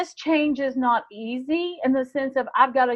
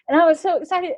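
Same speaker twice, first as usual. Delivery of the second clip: quick, louder, higher-pitched gush; excited; 5 semitones higher, 5 dB louder, faster than usual. Retold or imitated. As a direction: rush it out, reliving the thrill.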